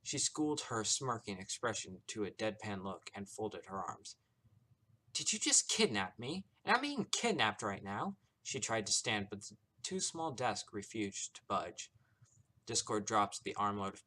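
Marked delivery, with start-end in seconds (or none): 5.1-8.2 high, loud, incredulous demand; indignant questioning. A narrator's reading. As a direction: confront the abductor in disbelief. Captive demands answer from abductor.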